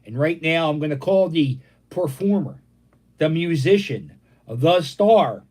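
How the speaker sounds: ordinary talk, nothing marked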